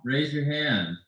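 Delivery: faint echoed repeat; quiet distant delayed copy. Real, not acted. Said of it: "we have a big echo in here".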